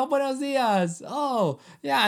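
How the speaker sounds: put-on Spanish accent. Host greets a Spanish-speaking cashier with a light Spanish accent. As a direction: chirp buenos dias with a hint of a Spanish accent. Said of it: "you say it with an accent".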